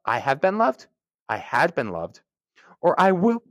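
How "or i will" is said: burp. Real, not acted. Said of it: "excuse me, with a burp".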